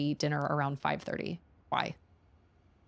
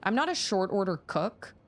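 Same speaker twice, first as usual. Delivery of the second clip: normal talking voice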